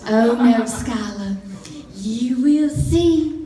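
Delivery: slow, knowing Southern mama impression; imitated Southern mom, unhurried and sure; sweet Southern accent. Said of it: "in her sweet Southern accent".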